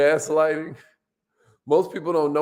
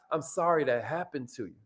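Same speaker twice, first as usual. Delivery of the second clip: soft, sincere and much quieter; gentle sympathy; 4 semitones lower, 8 dB quieter than usual. Shown as a demonstration. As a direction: say it gently, like you mean it. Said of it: "what if you try".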